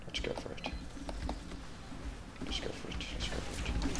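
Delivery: hushed whisper. Real, not acted. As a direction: whisper it while sneaking. Sneaking up on enemy.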